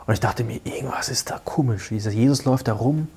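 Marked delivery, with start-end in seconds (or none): 0.6-1.9 a hushed, breathy inner voice; suspicious whisper to self; whispered thought. Retold or imitated. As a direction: whisper it to yourself.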